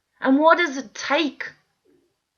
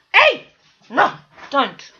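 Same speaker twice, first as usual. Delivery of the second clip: shout at dog; 6 dB louder than usual. Real, not acted. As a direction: yell at the dogs to break it up. Scolding the fighting dogs.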